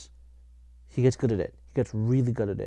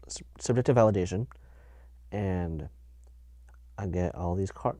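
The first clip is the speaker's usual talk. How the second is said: ordinary talk, nothing marked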